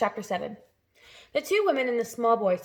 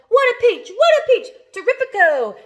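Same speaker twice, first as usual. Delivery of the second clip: very high, loud gleeful cries; excited cry; 12 semitones higher, 11 dB louder than usual. A narrator's reading. Aunt cries out over the peach, very high and loud. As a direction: squeal with greedy delight. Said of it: "Aunt Sponge cried out".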